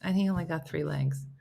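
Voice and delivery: ordinary talk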